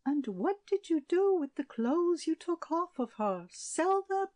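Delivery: high, sharp queen's scorn; mock her with the question; scornful queen. A narrator's reading. Queen sneering at the shepherdess, high and scornful. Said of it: "queen interrogating".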